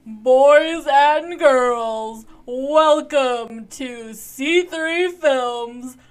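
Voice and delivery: booming, slow announcer voice; grand entrance announcement. Acted. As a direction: announce it like a boxing ring host. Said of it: "I am your announcer voice".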